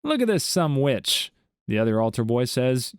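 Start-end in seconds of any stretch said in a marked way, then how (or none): 0.0-1.3 higher, slow, showing off the catch; proud proclamation. A narrator's reading. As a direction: announce the fish proudly, drawing it out.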